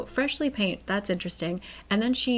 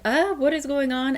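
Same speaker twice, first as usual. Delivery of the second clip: higher-pitched mock bewilderment; confused; 6 semitones higher, 4 dB louder than usual. Husband faced with an unknown maid, acting mildly confused. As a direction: act baffled, pitch lifted. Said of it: "he looks really confused".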